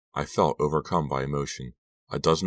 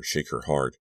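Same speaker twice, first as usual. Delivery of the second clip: plain reading voice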